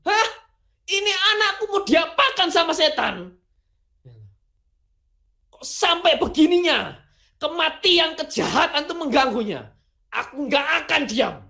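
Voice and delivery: a dad who won't stand for it; protective outrage. Retold or imitated. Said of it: "Kira-kira begitu".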